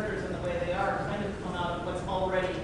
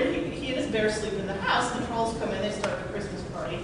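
usual speaking voice

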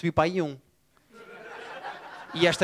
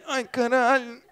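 put-on old man groaning; 4 semitones higher than usual. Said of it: "estão sempre assim".

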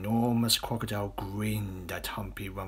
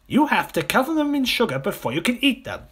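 high, loud, protesting croc; disgusted smaller crocodile; 8 dB louder than usual. Acted. Smaller crocodile arguing children taste bad, high and disgusted. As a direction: complain shrilly as the little croc.

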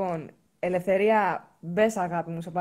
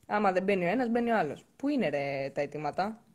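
normal talking voice

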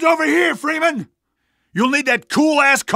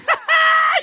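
high whooping laugh. Acted. Celebrating the victory.

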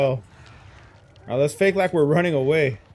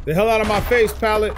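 higher, louder irritated shout; loud snap; 8 semitones higher, 4 dB louder than usual. Real, not acted. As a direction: bark it at the pallet. Killer snapping at pallet.

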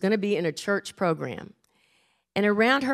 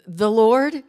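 louder, questioning start, imitating a nervous child; nervous little girl; 5 dB louder than usual. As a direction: play a child stuck at the first words. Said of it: "she got a little nervous".

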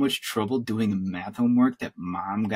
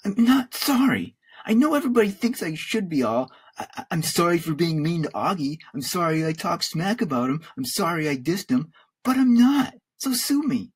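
high-pitched defiant rant; yelling; 8 semitones higher than usual. A narrator's reading. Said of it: "I yelled".

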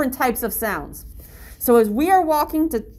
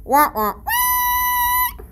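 blackbird call that leaps very high; a lightly imitated bird call with a high squeal; slower than usual. Shown as a demonstration. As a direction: mimic the red-winged blackbird. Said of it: "they sound like this".

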